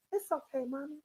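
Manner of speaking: soft, soothing reassurance; hushed and kindly; calm, pleasant. Retold or imitated. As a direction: imitate a gentle son comforting his mother. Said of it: "He said with calmness ... with a pleasant voice".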